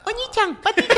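high, loud mock-cute call; playful squeal. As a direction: squeal it loudly as a silly call. Repite el llamado en voz juguetona. (chillido juguetón, agudo y fuerte)

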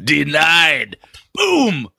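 high, loud, crowing verdict; mock triumphant denial. Co-host laughs at a listener's accent complaint, mock triumphant. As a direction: play up a gleeful, high-pitched smackdown.

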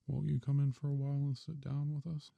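lightly voiced kind old mother; kindly mother voice. A narrator's reading. Mother voice, higher timbre.

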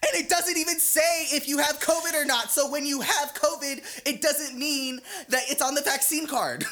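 very high, strained mock scream; imitated screaming. Woman fighting with her man in public, imitated screaming. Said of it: "she starts screaming and she goes".

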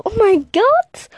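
viel höher und lauter, entsetzt; gespielter Schock. Retold or imitated. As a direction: kreisch empört über den Spoiler. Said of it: "Und der so". (übertrieben schockiert, Schlusswort lang gezogen)

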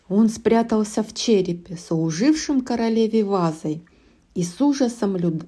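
ordinary delivery

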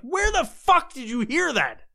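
high-pitched incredulous challenge; indignant retort. Retold or imitated. Host answers someone spreading rumors, indignant.

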